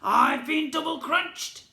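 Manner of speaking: slow, loud, high goblin wail; goblin yell. A narrator's reading. Goblin prince complaining of being cheated, slow loud yell. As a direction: drag it out in outrage. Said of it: "Prince Gobby yelled".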